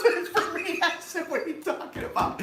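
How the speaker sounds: high laughing disbelief; retelling a friend's prank, laughing hard. Retold or imitated. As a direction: squeal through the laughter. Retold punchline, laughing.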